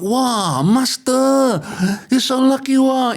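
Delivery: high, loud amazed servant; excited servant. Acted. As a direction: gasp at your master's luck. Servant voiced in story.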